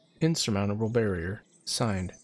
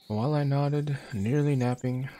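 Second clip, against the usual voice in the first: dramatic recital; 4 semitones higher than usual. Acted. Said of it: "reads poem dramatically".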